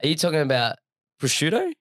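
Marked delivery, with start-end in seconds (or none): 1.2-1.7 'prosciutto' as pruh-SHOO-doh, rising to a question; Australian pronunciation. Shown as a demonstration. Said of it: "If I'm Australian, I'm just gonna say prosciutto".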